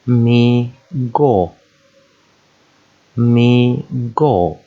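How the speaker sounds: Mi go as two long, separate beats; syllable by syllable. Shown as a demonstration. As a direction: sound out Mi, pause, go. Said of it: "practice joining two syllables together".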